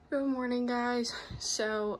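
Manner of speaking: normal talking voice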